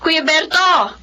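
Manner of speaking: loud anxious call to the neighbor. Acted. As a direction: call out to him in worry. Mother calls neighbor in alarm.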